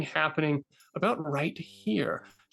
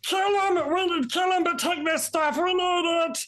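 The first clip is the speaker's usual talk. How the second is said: furious shout; 13 semitones higher than usual. Acted. Goblin captain yelling for the kill. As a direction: scream the order to attack. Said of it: "Captain Restaurant is like".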